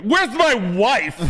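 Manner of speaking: very high, very slow wail; mock realtor voice. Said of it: "my brother and I was like".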